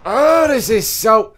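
high, loud, slow, strained wail; overwhelmed cry. Real, not acted. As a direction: cry out as it all goes wrong. Overwhelmed in game fight.

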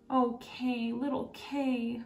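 sighing. Acted. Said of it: "Gerda sighed".

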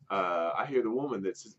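usual speaking voice

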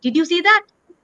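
rising pitch, a stern teacher pressing the point; lightly voiced scolding guru; a hint of a teacher calling her out. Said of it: "ऐसे डांट दिया मेरे को".

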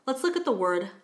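ordinary talk, nothing marked

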